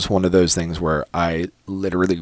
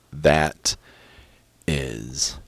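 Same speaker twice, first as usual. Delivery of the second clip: hushed awe; 5 semitones lower, 7 dB quieter, much slower than usual. Retold or imitated. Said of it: "I was just like".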